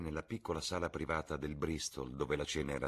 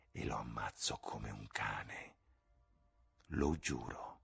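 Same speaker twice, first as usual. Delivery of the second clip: intense, dramatic threat; 2 semitones lower, 4 dB quieter, slower than usual. Acted. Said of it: "espressa in toni intensi e drammatici".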